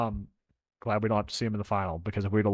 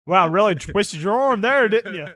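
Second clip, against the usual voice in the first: high, loud mock sympathy; teasing a friend who claims he had to drink; sarcastic tease; 9 semitones higher, 7 dB louder than usual. Real, not acted. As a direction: rib him for the shower beer, pitched up and loud.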